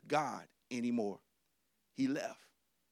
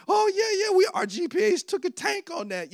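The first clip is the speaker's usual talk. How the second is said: excited reminiscing; 14 semitones higher, 7 dB louder than usual. Retold or imitated. Excited remembering with roommate.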